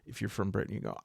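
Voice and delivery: ordinary delivery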